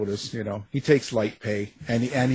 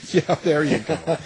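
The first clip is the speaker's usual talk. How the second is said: ordinary talk, nothing marked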